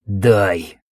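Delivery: low raspy demand; hoarse rasp. Acted. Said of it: "прохрипел боец".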